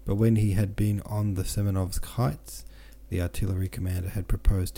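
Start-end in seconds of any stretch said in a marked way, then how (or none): none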